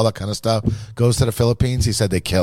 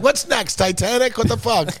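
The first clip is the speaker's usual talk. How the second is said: higher pitched joking quote; high mock outrage; 12 semitones higher than usual.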